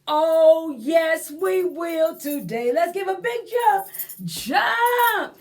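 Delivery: loud, high, eager call; excited cheer. Real, not acted. Exciting children to jump.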